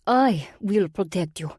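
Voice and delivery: put-on slow, biting Russian-accented promise; angry bite. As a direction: let the anger bite through. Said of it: "couldn't hide the angry bite in her voice".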